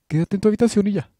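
fast, very high, very loud yell; furious shout. Acted. Headmistress screaming at Candy to stay in her room, fast furious yell. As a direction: blow up and rattle it off.

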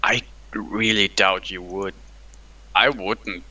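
ordinary talk

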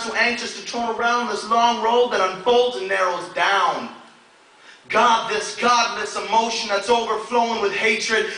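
loud, high, driving slam poetry recital; urgent poetic prayer, pitched well above normal talk; spoken word poem. Acted. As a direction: perform it as an impassioned plea to God.

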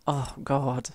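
usual speaking voice

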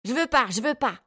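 high-pitched tantrum protest; defiant child's cry. A narrator's reading. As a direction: refuse like an angry little boy. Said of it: "cria-t-il en retour".

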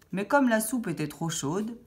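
ordinary delivery

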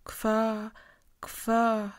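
stammered syllables, straining; stammering man struggling to start a word; stuttering. A narrator's reading. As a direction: force each syllable out, stuck. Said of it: "wybełkotał, krzywiąc się i zaciskając pięści".

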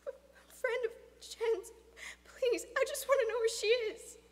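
high-pitched, halting plea; anxious pleading. Acted. Boy begging a stranger about his friend, anxious. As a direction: plead timidly in a high boy's voice.

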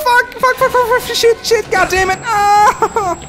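rapid shrieked swearing; panicked cursing. Real, not acted. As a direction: swear in blind panic while fleeing. Fleeing the monster.

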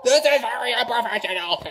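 giggling through the complaint; losing it while scolding; laughing. Real, not acted. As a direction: repeat it breaking into real laughter.

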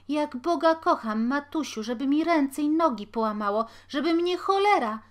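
high, loud squealing plea. A narrator's reading. Boy swearing innocence to his mother while beaten: high squeal. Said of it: "piszczał chłopak".

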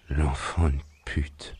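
slow, drawn-out curse on coming round; groggy curse. Acted. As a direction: curse slowly, barely conscious. Man coming round curses weakly. (juron lent, à demi conscient)

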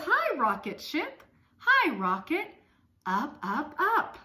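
put-on cheery child greeting. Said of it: "She says".

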